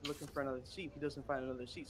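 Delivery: normal talking voice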